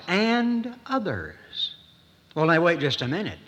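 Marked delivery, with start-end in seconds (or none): 0.1-1.7 "and others" drawn out slowly, pitched higher; slow solemn repeat. Retold or imitated. Preacher repeating the Hebrews phrase to congregation, slow and lingering. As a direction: stretch "and others" long and high with a falling end. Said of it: "He says it twice".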